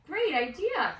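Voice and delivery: delighted. Acted. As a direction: praise her idea warmly. Father delighted.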